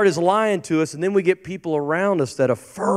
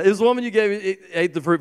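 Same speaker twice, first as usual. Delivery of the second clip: mock excuse; much faster than usual. Adam's excuse repeated, mocking.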